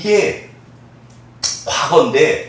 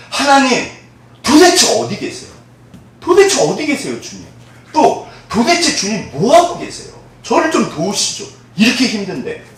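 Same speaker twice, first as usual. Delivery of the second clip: pleading cry to God; 6 semitones higher than usual. Acted. Acted complaint to God. (하나님께 따지듯 높은 목소리로)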